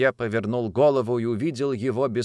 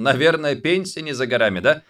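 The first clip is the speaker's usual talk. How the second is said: joking tone; 5 semitones higher, 4 dB louder than usual. Acted. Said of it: "добавил шутливым тоном".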